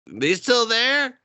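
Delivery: high, slow hiding whisper-question; slow, nervous, hiding. Retold or imitated. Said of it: "They're like".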